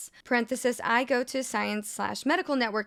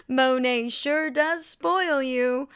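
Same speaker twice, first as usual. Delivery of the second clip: sarcastic; 3 semitones higher, 4 dB louder, much slower than usual. Real, not acted. Sarcastic jab at the MLM.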